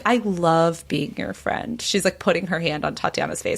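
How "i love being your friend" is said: slow, drifting, lightly drugged-sounding; woozy. Retold or imitated. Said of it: "like, woozy".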